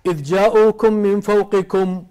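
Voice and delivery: slow, drawn-out chanted verse; Quran recitation. Acted. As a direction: recite it like tajweed.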